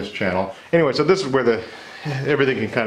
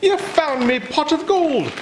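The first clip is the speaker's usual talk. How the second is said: hammed-up Irish leprechaun voice; 12 semitones higher than usual. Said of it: "apologize for every Irish viewer".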